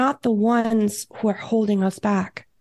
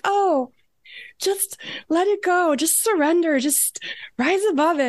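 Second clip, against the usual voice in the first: mock spirit guides; 7 semitones higher than usual. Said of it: "And they're like".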